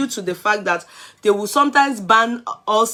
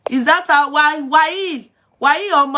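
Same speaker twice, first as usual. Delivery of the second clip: higher, louder indignant why why; indignant outburst; 7 semitones higher, 5 dB louder, slower than usual. Real, not acted. Reading a rude commenter's words, loud indignant outburst. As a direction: burst out in outrage at the comment.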